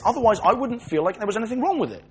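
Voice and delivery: ordinary talk, nothing marked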